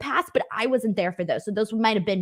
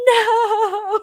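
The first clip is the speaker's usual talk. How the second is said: dream no; 11 semitones higher, 5 dB louder than usual. Retold or imitated. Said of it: "I was just like this".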